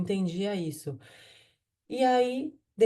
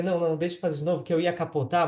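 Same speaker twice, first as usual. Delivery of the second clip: hurried redo; 2 semitones lower, faster than usual. Retold or imitated. Videogame-like redo in dream.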